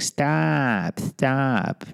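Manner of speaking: put-on a timid kid saying stop; meek pleading. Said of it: "I'd be like".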